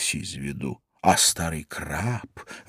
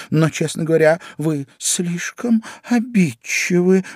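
high, earnest protest; pleading Alice; 9 semitones higher than usual. A narrator's reading. Alice tells mouse she is touchy, high and pleading. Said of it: "взмолилась Алиса".

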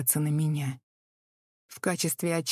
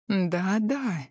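languid; 3 semitones higher than usual. Acted. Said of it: "томно произнесла я".

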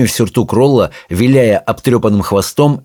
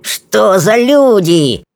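high, drawn-out indignation; fuming; 11 semitones higher, much slower than usual. Acted. Rolla fumes at the people who bagged him, high indignant whine. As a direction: seethe in a high kobold voice. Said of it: "кипятился Ролла".

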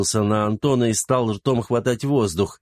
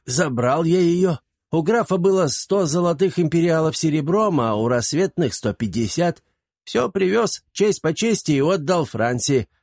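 put-on proud old servant; 7 semitones higher than usual. A narrator's reading. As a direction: boast like a crafty old servant.

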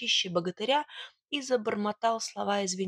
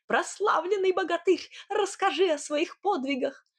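high-pitched eager calling-out; excited reporter's holler; yelling; 10 semitones higher than usual. Acted. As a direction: cry it out in a raised voice. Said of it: "вопя".

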